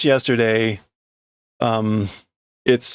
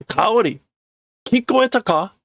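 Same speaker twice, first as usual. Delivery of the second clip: much higher urgent question; man asking Kaori about a noise, high; character voice; 10 semitones higher than usual. Acted. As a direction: ask her if she heard, alarmed. Calling Kaori.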